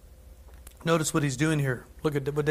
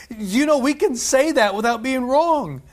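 ordinary delivery

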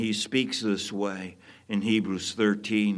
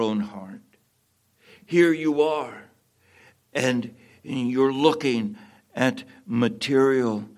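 preaching appeal; 2 semitones higher, 4 dB louder than usual. Acted. Pastor challenging the congregation about trust, fervent. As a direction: press each listener with the questions.

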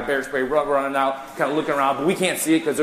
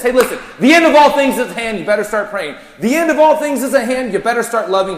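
much higher and louder, pressing and urgent; urgent warning; 9 semitones higher, 8 dB louder than usual. Acted. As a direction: warn them that the end is at hand. Preacher voicing Peter's urgent warning.

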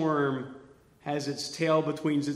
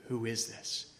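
quiet wonder; 6 semitones lower, 12 dB quieter than usual. Shown as a demonstration.

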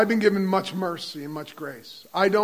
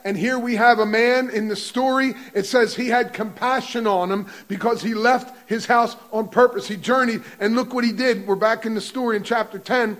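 preaching with fervour; 3 semitones higher, 4 dB louder than usual. Acted. Preacher exhorting the congregation.

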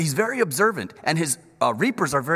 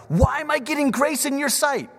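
much higher, louder wondering question; amazed question; 11 semitones higher, 5 dB louder than usual. Retold or imitated. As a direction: ask it in disbelief at the kindness. Ruth asks in wonder.

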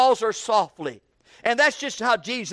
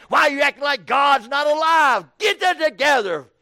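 louder, scolding pretend rebuke; mock rebuke; 5 semitones higher, 3 dB louder than usual. Preacher acting a scolding God to a downcast believer, mock angry. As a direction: scold her the way he never would. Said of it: "He don't talk to her that way".

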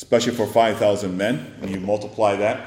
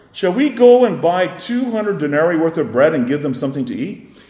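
sarcastic objection; 6 semitones higher, 7 dB louder than usual. Retold or imitated.

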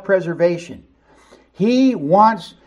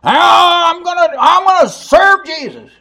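much higher, loud boasting shout; brash Peter; 11 semitones higher, 7 dB louder than usual. Acted. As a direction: yell it like a hothead drawing his sword. Peter boasting, swinging his sword.

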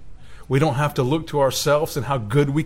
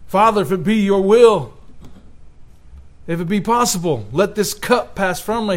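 raised, earnest plea to the Father; pleading prayer; 6 semitones higher, 4 dB louder than usual. Retold or imitated. Preacher voicing Jesus begging the Father, raised pleading. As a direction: cry out as Jesus begging to be spared.

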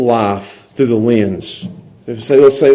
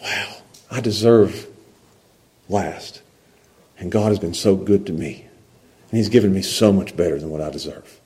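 low, soft, grateful wonder; humble gratitude; 5 semitones lower, 7 dB quieter than usual. Acted. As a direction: drop into quiet, awed thankfulness. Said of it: "put the grace lens on that says".

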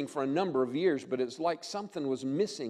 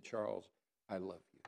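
1 tender hush; 9 semitones lower, 13 dB quieter than usual. Retold or imitated. Father's embrace voiced in story.

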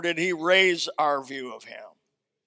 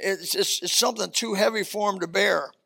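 ordinary talk

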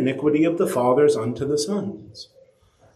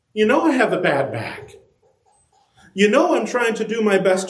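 higher, pleading and hurt; hurt wife; 8 semitones higher than usual. Retold or imitated. Preacher voices the wife with the bad back, hurt by the pans. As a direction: plead like a wife near tears.

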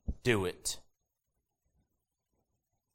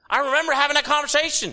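a burst of a much higher, louder remembering; loud exclamation; 9 dB louder than usual. Real, not acted. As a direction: blurt it out loud and high.

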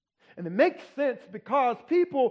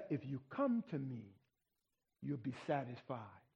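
much softer, lower, tender promise; gentle promise; 7 semitones lower, 14 dB quieter, faster than usual. Acted. Preacher voicing the Lord's promise to the hungry, soft and tender. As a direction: drop to a soft, tender hush.

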